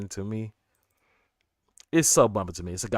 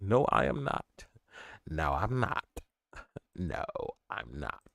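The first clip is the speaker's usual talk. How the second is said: softer, lower, repeated denial; Dark Knight quote; 5 semitones lower, 7 dB quieter than usual. Retold or imitated. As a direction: quote the movie line, settling lower each time. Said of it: "That quote's from The Dark Knight".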